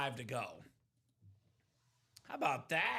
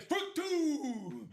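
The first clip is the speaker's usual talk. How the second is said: umpire call; 9 semitones higher than usual. Retold or imitated. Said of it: "I'd be like".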